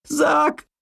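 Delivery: high piercing cry. Acted. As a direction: cry out his name. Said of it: "крикнула Лу".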